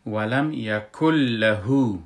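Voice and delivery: «له» with lām merged and doubled, nūn dropped; slow Quran recitation of «ولم یکن له», lām of «له» doubled; recited with idgham. Shown as a demonstration. Teacher slowly demonstrating merged «له» for students.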